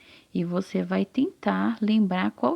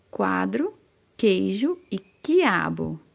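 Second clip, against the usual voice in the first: slow dictation; slower than usual. Shown as a demonstration. Teacher dictates words.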